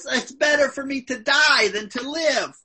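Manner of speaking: high-pitched heated complaint; angry Jonah. Retold or imitated. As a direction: act out the prophet's renewed anger. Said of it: "Now he's hot again".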